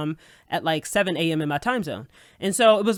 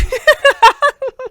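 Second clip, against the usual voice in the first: big high incredulous cackle; loud shrieking laugh; 9 dB louder than usual. Real, not acted. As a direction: burst out laughing in disbelief. Laughing at absurd accusation.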